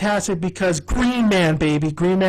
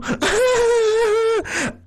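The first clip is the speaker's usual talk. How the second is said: long shrieking mock giggle, very high; put-on high laugh. D laughs at her own teasing question, high put-on cackle. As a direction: trill out a fake high laugh. Acting D's character's laugh.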